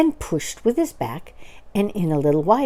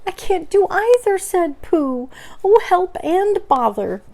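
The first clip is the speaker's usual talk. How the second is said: high plaintive voice; distressed bear; 12 semitones higher than usual. Acted. Pooh stuck in the hole, high plaintive. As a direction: wail it plaintively.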